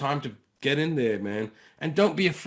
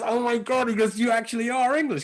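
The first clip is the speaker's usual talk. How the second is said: high, louder mock amazement; mind blown; 9 semitones higher, 5 dB louder than usual. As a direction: imitate a man stunned by the reveal. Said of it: "his mind blown".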